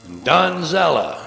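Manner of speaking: knowing stress on "Donzella"; emphasized name. Shown as a demonstration. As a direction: land hard on "Donzella". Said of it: "by emphasizing the key names".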